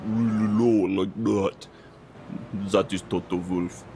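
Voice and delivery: low voice disguised like a documentary witness; low, masked interview voice; scrambled anonymous voice. Retold or imitated. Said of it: "they, like, scramble a voice on".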